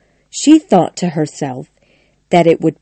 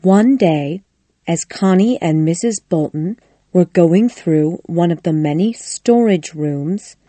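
usual speaking voice

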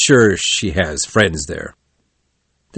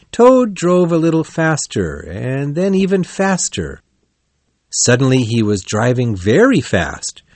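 plain reading voice

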